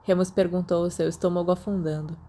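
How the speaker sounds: usual speaking voice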